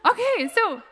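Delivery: high, loud laughing okay. Real, not acted. Telling the audience her reply, laughing high and loud. Reacting to dad's apology.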